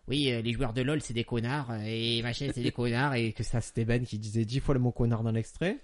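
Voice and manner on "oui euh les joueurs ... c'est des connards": quoting a guy from a clip. Retold or imitated. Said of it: "un extrait avec un mec qui dit".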